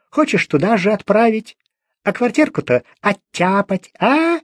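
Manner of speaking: put-on high, needling old woman's voice; suspicious old crone. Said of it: "старуха". (подозрительная старуха, высоким въедливым голосом)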